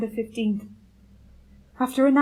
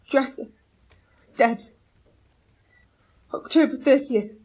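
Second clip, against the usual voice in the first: usual speaking voice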